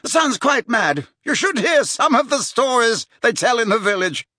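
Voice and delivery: put-on high-pitched, carrying young man's chatter; dismissive young gentleman. A narrator's reading. Tom telling the girl about Gaunt, scornful.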